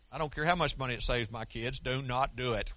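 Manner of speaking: ordinary talk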